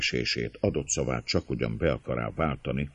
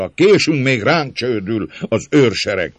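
loud, high, rude warning; rough voice; 9 semitones higher, 9 dB louder than usual. A narrator's reading. Man at the gate warning of the guards, rough. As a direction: urge them roughly, voice raised. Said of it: "durva hangon a kapu mellett állók egyike".

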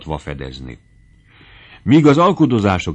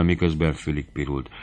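ordinary delivery, nothing marked

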